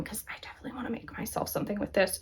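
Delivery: quick breathy whisper, half to herself; whispered aside. Real, not acted. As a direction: let it slip out as a quick secret whisper. Whispered aside about the yarn.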